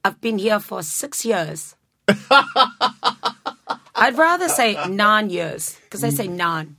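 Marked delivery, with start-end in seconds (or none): 3.9-6.8 slow, laughing South African accent attempt; a light, giggly stab at a South African accent. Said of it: "can you do a South African accent?".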